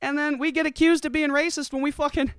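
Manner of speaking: high sarcastic voice voicing poor whites; high mocking rant. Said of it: "It's like".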